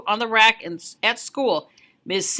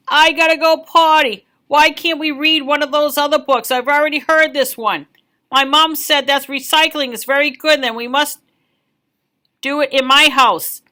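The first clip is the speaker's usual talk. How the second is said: put-on higher, louder little-kid voices; whiny kids; 4 semitones higher, 6 dB louder than usual. Children interrupting story time, loud and whiny. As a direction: chatter like restless children. Kids all talking at once.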